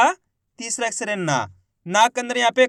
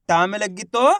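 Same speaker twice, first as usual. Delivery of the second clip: ordinary talk, nothing marked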